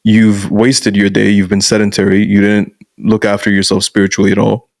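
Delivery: ordinary talk, nothing marked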